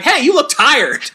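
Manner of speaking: high, drawn-out mock concern; imitating a neg disguised as a compliment; mock backhanded compliment. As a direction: stretch it out high, like a subtle dig. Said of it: "a neg in some weird way".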